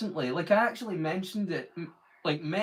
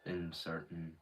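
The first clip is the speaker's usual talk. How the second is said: much lower, softer natural register; low relaxed voice; 11 semitones lower, 7 dB quieter than usual. Shown as a demonstration. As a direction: drop into your deep relaxed register. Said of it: "I talk like this".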